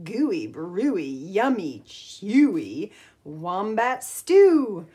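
put-on lively storybook read-aloud for kids; reading to children. A narrator's reading. Teacher reading a picture book to the class, lively storytime voice.

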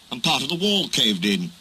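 plain reading voice

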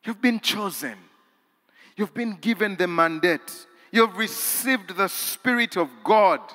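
high, loud rising sermon climax; full-force preaching. Acted. Preacher exhorting the congregation that they are chosen, high and loud. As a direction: drive it home with fervor.

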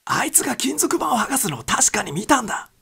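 desperate whispered claim; intense whisper. Acted. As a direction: hiss that he truly saw it. Wilson insisting intensely.

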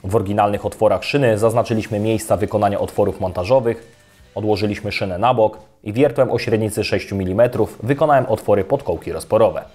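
normal talking voice

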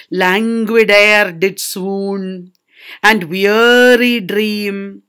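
very slow, drawn-out recitation; languid dullness. Shown as a demonstration. Teacher quotes words creating a dull effect, languid drawl. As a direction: stretch the vowels wearily. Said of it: "dull effect with words like".

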